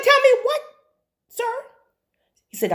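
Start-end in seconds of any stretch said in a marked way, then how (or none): 0.0-1.5 much higher, louder "tell me what"; spring it out in disbelief, way up high; shocked question. Retold or imitated. Shocked reply to his apology.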